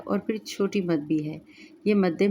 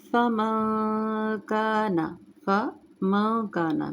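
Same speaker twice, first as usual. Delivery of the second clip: slow melodic tajweed chant; Quran recitation; 3 semitones higher, much slower than usual. Shown as a demonstration. Full recitation again.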